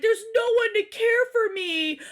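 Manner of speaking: high-pitched mocking wail of a husband; mock self-pity. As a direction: wail it as his melodramatic plea. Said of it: "And he's like".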